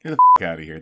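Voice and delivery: loud playful protest, bitten-off curse; mock outraged shout. Real, not acted. Cohost denying the swearing, mock outraged shout. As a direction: shout it down as a joke.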